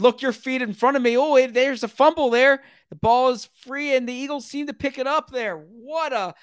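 high, loud live play-by-play; excited fumble call. Real, not acted. Announcer calling a fumble on the punt, excited. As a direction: call the sudden turnover with rising excitement.

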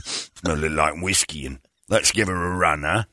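low, slack aging rocker voice. Acted. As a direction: sink it deep and let the words slump.

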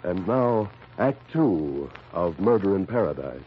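ordinary talk, nothing marked

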